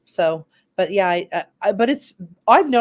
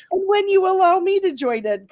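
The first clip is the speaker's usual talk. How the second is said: much higher mock voice; teasing imitation; 12 semitones higher than usual. Co-host mimicking the host's thanks, teasing imitation. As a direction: mimic him in a pitched-up, teasing voice. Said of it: "'Cause you go".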